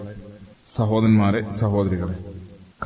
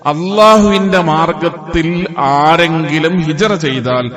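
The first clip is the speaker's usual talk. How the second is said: higher-pitched sermon proclamation with swelling emphasis; emphatic, raised khutbah delivery; fervent preaching; 6 semitones higher than usual. Acted. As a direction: preach the promise with force.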